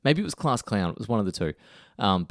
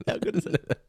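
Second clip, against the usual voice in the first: laughing; 9 semitones higher than usual. Real, not acted. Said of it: "amused reaction".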